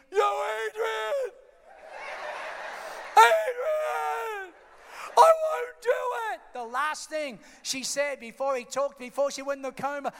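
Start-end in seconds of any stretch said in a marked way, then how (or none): 0.1-6.4 anguished mock bellow, much higher; loud, drawn-out impression of a distraught fighter; Rocky. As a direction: wail her name like a hammed-up boxer. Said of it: "here's Rocky laying by the bed".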